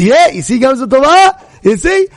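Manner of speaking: loud, rising vindication; triumphant father. Retold or imitated. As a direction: crow it, high and loud. Father triumphant at found shoe.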